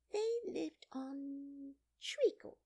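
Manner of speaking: high, slow, whispery dormouse voice; half-asleep little creature; sleepy Dormouse. A narrator's reading. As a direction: tell it drowsily in a feeble high voice.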